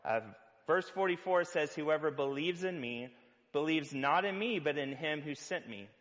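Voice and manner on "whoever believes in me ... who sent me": normal talking voice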